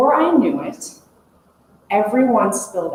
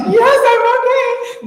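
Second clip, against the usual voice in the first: much higher, louder, squeaky reassurance; squeaky yes; 5 dB louder than usual. Retold or imitated. Answering the guide after surviving the river, high and squeaky. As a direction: squeak it out, still half laughing.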